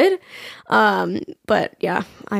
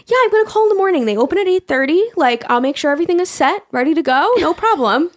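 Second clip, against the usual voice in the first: fake breezy calm; 7 semitones higher than usual. Faking calm to friend.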